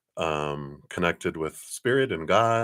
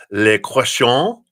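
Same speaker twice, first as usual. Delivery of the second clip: put-on playful French accent, higher and louder; mock French accent; 5 semitones higher, 9 dB louder, slower than usual. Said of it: "That's my French accent".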